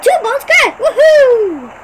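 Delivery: high, loud whoop; cheering. Real, not acted. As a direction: whoop in triumph. Celebrating success.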